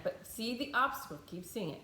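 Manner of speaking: usual speaking voice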